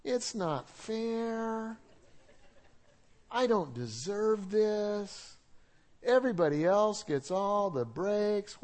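slow, whining mock complaint; whiny complainer. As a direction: drag out the self-pity. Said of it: "Do you know anybody that's just whiny".

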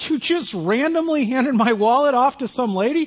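acted disbelief, pitched high; incredulous. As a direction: question him as if you can't believe it. Retold disbelief at the stranger.

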